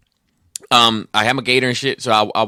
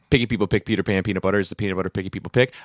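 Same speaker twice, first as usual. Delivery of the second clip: fast, run-together patter; rattled-off tongue-twister; faster than usual. Pretend. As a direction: reel it off in one quick breath.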